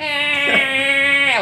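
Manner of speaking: loud, very high-pitched sustained wail; mock crying wail. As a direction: imitate a sitcom character's crying scream, piercingly high and drawn out. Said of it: "like, the crying or screaming. Sorry if that was really high pitched".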